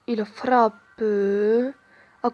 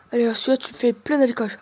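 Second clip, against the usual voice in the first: normal talking voice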